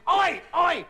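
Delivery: a hint of a London cabbie recognising a star. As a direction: call out like a chirpy cabbie. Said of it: "turns to Griff and says".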